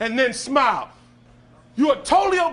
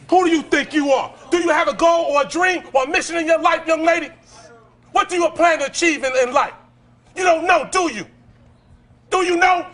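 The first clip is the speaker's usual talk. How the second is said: angry grilling; 4 semitones higher than usual. Real, not acted. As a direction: demand an answer, shouting. Interrogating defiant teen.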